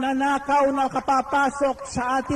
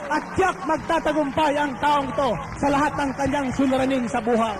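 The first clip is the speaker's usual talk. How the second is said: fervent preaching; 6 semitones higher than usual. Acted. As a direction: preach it out with rising fire. Preacher rises to full fervour.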